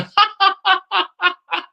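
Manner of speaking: high rapid-fire cackle; mocking laugh. Real, not acted. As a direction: burst into a high laugh at a rival's misfortune. Laughing at rival's defeat.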